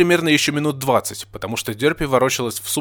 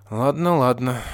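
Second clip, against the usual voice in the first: drawn-out, sighing surrender; resigned. A narrator's reading. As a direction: give in with a doomed sigh. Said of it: "обречённо вздохнула Нина".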